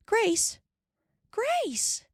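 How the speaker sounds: high loud call. A narrator's reading. Ma calls for her lost daughter, high anxious call. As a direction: call her name across the prairie. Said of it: "and she called".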